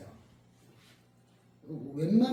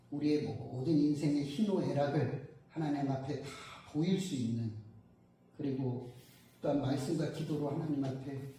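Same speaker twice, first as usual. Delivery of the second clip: closing prayer; 5 semitones lower than usual. Real, not acted. Preacher praying to God to close the sermon, low and solemn. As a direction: pray aloud in a lowered, measured tone.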